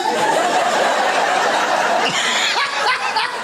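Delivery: a breathless, high burst of laughter; a high cackle of real laughter; high airy laugh. Real, not acted. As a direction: laugh hard at your own joke. Laughs at his own comeback.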